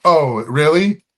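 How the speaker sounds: loud, slow, rising mock surprise; sarcastic, drawn-out questioning; mock challenge. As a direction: draw it out as a pointed challenge. Acts out challenging an offended crowd.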